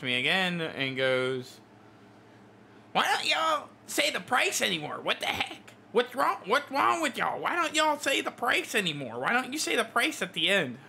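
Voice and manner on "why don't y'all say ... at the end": high, whiny mock of a rude customer; shrill-free nasal-less high pitched mock complaint, exaggerated.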